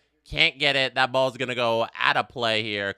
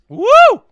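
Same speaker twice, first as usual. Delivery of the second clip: loud high celebratory woo; excited whoop; 14 dB louder than usual. Real, not acted. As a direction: let out a big cheer. Celebrating first corner.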